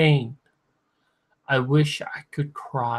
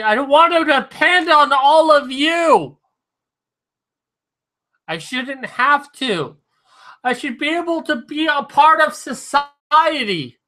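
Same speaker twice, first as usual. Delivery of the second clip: raised, high-pitched distraught outburst; real distress; 10 semitones higher, 4 dB louder than usual. Real, not acted. Drunk streamer venting to viewers about dependence, distraught. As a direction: protest in high, upset voice.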